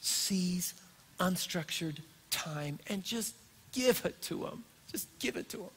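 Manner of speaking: soft, slow exhortation; quiet earnest charge; hushed appeal. Acted. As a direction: urge them gently, barely above a breath. Emotional sermon close.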